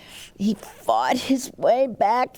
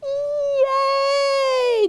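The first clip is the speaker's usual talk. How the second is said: cheering. Acted. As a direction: shout it like a whole class cheering. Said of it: "cheered all the students".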